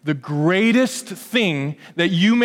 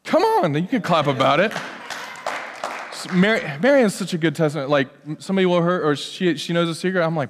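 ordinary talk

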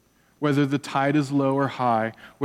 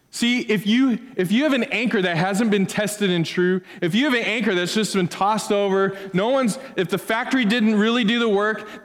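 preaching at full force; 7 semitones higher than usual. Acted. Pastor pressing the congregation about untested anchors, fervent preaching. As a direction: preach it like the storm is coming. Builds to the storm warning.

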